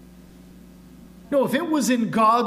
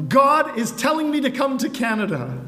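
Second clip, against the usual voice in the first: raised, higher voice, mock shouting down the phone; half-shouted, pitched well up; retold frustrated shout; 6 semitones higher, 4 dB louder than usual. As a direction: imitate a frustrated caller insisting loudly. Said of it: "he shouted over the phone".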